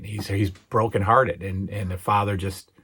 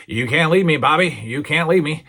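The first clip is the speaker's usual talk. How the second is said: higher, louder mock-distraught plea; imitating distraught pleading; 7 semitones higher, 6 dB louder than usual. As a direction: act out a hammed-up bedside farewell. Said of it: "has this extremely emotional moment".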